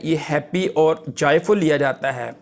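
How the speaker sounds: usual speaking voice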